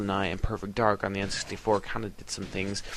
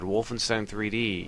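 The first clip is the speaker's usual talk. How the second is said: normal talking voice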